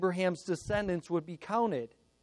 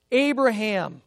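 louder, insistent summons by name; raised-voice solemn call; urgent calling out; 3 semitones higher, 5 dB louder than usual. Acted. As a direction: call out to stop him. God calls Abraham a second time.

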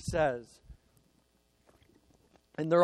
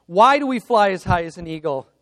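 loud, drilled quiz question; rallying call; 9 dB louder, faster than usual. Retold or imitated. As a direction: call it out for a response. Father quizzes daughter in rallying chant.

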